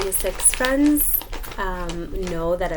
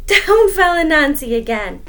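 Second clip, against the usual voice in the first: loud, higher wail; dramatic cry; 8 semitones higher, 11 dB louder than usual. Acted. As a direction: wail the fall. Dramatic fall.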